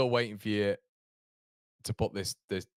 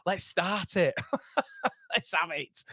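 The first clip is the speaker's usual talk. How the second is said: high, laughing call to start singing; laughing rally cry; 8 semitones higher, slower than usual. Retold or imitated. Fan retelling starting a chant at the gig, laughing. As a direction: egg the crowd on through laughter. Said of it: "I was like".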